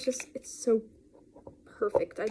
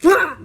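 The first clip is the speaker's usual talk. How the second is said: sour shock yelp; 8 semitones higher, 15 dB louder than usual. Retold or imitated. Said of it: "it's like".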